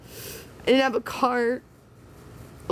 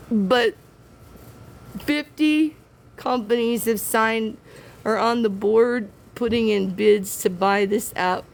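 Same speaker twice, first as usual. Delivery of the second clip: usual speaking voice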